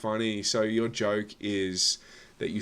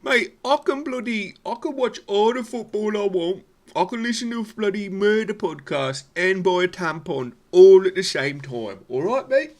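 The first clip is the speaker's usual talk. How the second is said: high, loud laddish impression of her; much higher and louder mocking mate voice; mock blokey lad; 11 semitones higher, 6 dB louder than usual. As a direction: ham up a brash Aussie bloke.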